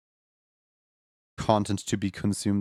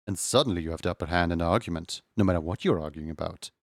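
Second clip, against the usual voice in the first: lower, softer voice held on; deeper voice; 6 semitones lower, 4 dB quieter, faster than usual. Shown as a demonstration. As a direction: keep the deep authority voice going. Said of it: "with a deeper voice".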